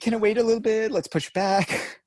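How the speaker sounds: lightly voiced parents dodging the request; hesitant parents. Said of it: "they'll be like".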